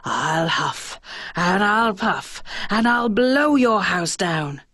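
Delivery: slow, drawn-out big bad wolf. A narrator's reading. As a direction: menace the pigs slowly as the wolf. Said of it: "So the big bad wolf said".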